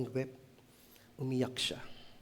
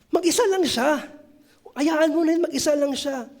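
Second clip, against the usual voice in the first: much higher, louder brushing-off voice; dismissive crowd; 10 semitones higher, 3 dB louder than usual. Retold or imitated. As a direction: wave off the one man. Crowd dismissing one seated man. (mataas at malakas na pagbalewala ng mga tao)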